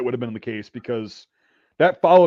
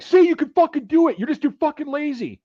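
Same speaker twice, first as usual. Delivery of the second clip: high, raised berating voice; imitated scolding yell; 12 semitones higher than usual. Instructors berating recruit who finally crossed, imitated scolding. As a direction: yell it as a scolding I-told-you-so.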